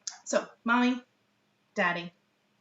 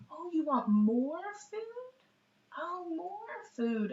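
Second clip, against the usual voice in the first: put-on slow, much higher voice for a toddler; high, slow parent talk; 10 semitones higher, slower than usual. Shown as a demonstration. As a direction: coo it slowly to a baby. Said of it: "parent modeling to child".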